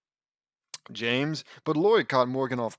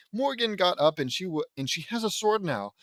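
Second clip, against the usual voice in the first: ordinary delivery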